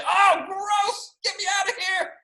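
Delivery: very high comic wail; mock disgusted shriek. You would feel like the boy, high panicked mock yell. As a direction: squeal like a grossed-out kid.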